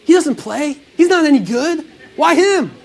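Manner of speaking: mock-offended crowd voice; scoffing crowd. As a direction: protest like an outraged bystander. Crowd scoffing at chosen man.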